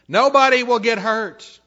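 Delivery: loud, high put-on voice; tempter's assurance. Preacher voices Satan's lie to the tempted, loud assurance. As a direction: pitch it way up and push it out.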